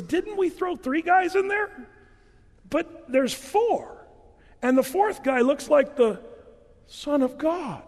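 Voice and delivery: high squeaky king, baffled; Don Knotts voice. Retold or imitated. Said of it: "Don Knotts".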